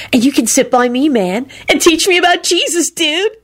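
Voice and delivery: high, goofy dude voice; merry cackling. A narrator's reading. Diner invites servant to teach him, merrily cackling. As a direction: tease in a high, merry voice without breaking into laughter. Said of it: "Kenny cackled merrily".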